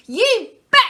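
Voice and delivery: put-on loud excited child yell. A narrator's reading. Lester yelling to his dad, loud and high. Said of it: "yelled Lester".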